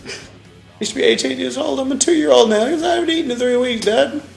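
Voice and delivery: put-on whiny kid voice. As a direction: whine at dad like a little kid.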